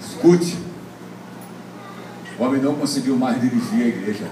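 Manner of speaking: ordinary delivery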